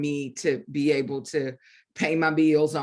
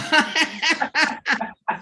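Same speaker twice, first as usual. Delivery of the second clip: a high, quick nervous laugh; 11 semitones higher, 4 dB louder than usual. Real, not acted. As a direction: laugh it off, a bit embarrassed. Nervous about the TV appearance.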